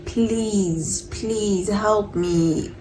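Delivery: soft, drawn-out retold plea; pleading. Girl begs Senzo to help, softly pleading. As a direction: stretch out the please, softly begging.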